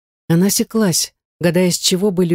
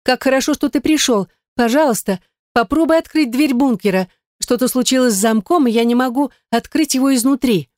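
lightly voiced Gaine; 7 semitones higher than usual. A narrator's reading. Gaine's voice on phone.

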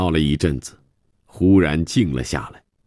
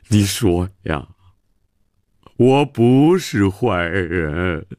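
slow, tearful, drawn-out pleading; self-pitying lament; slower than usual. Acted. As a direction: bewail your lot, dragging out the words. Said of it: "自怨自叹地说". (自怨自叹地哭诉)

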